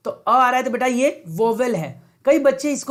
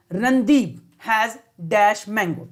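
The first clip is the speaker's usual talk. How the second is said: slow dictation read; much slower than usual. Shown as a demonstration. Teacher reads the sentence slowly.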